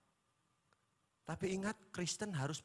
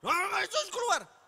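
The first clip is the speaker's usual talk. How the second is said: much higher, louder exorcism command; mock exorcist shout; 14 semitones higher, 11 dB louder than usual. Pastor mimicking friend casting out demon, mock shout. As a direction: bark the demon out, hammed up. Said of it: "ini tan gurine ki tan setan apa Nathan".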